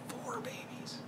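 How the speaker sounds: a breathless stunned whisper; awed whisper. Real, not acted. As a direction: hush it like a shocking secret. Punchline about quadruplets.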